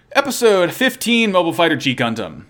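announcer. Acted. Announcing episode title.

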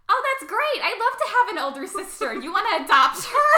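girl to her dad, mock-sweet sarcasm; mock-cheerful kid. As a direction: chirp it like a kid playing dumb. [name]'s sarcastic reply to Dad.

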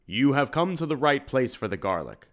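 plain reading voice

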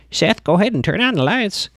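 a hint of a creepy old lady giving orders. Doll shop lady to Seth, lightly voiced creepy.

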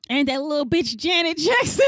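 hammed-up gossip voice; imitating Sissy. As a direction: play Sissy sneering at a rival. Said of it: "Sissy goes".

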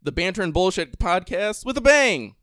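announcer. Acted. Podcast intro announcement.

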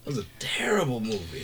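hushed, breathy verdict; whisper. Real, not acted. Whispering to friends that the movie was terrible. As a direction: whisper it like a secret.

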